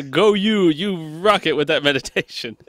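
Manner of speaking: high-pitched fake cheerleading; sweetly over-supportive, put on; mock encouragement. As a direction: cheer them on sarcastically. Joins in the sarcastic encouragement.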